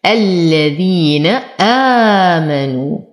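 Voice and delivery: الذين آمنوا with two-beat madd; slow recitation. Shown as a demonstration. Teacher recites the phrase slowly for students. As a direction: draw آمنوا with a measured lengthening. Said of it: "حركتين".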